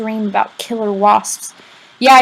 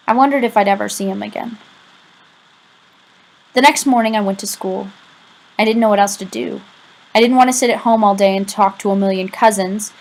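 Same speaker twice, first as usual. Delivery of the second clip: plain reading voice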